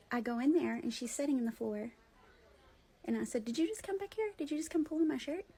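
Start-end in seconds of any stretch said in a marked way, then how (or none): 3.4-5.5 high-pitched, retold question to a little kid; questioning a child. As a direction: ask a small child, pitched up.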